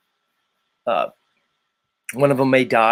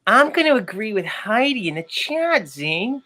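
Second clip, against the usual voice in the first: much higher put-on woman's voice; role-play her, pitched way up; playing Special K; 8 semitones higher than usual. Host plays Special K in role swap. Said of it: "I'm gonna be Special K".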